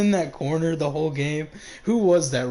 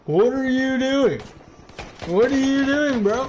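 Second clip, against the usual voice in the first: loud, high disbelieving calls; loud incredulous shouting at a teammate; incredulous yelling; 8 semitones higher, 4 dB louder than usual. Real, not acted. Caster yells at camping player.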